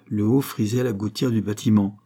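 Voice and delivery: ordinary delivery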